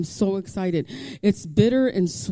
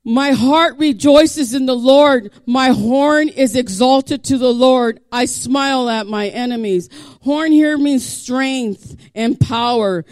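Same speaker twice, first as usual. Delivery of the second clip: louder, slowing preacher's cadence; preaching; 7 semitones higher, 9 dB louder, slower than usual. Acted. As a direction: proclaim it to the congregation. Builds into preaching.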